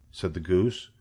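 ordinary delivery, nothing marked

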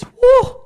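high, loud whoop; excited woo. Acted. Preacher cheering the no-tax reward, excited woo. Mock delight at tax exemption.

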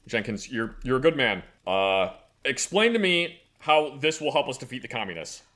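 low, gruff boss voice; goofy muffled sock puppet. Acted. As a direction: play a pompous low-voiced official. Plays the official in the skit.

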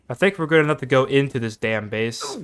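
ordinary talk, nothing marked

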